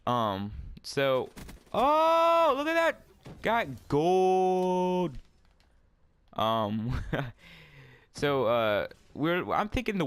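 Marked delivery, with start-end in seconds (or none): 1.7-5.2 very high, loud drawn-out whoop; excited cheer. Real, not acted. Player celebrating getting gold, excited high whoop. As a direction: crow over a sudden win.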